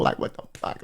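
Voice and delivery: whispered under the breath; muttered inner thought. Retold or imitated. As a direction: whisper it to yourself, barely audible. Inner thought while holding back tears.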